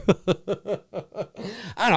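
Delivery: slow, quiet, laughed out; laughing mumble. Real, not acted. Giving up amused, slow laughing trail-off.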